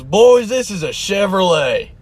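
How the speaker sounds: cocky customer. Retold or imitated. Customer brags to store workers about his truck, slow and proud. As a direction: boast about the truck like it can't fail. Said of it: "He goes".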